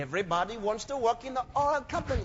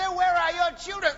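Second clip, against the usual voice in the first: mock loud question; 7 semitones higher, 4 dB louder than usual. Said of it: "They say".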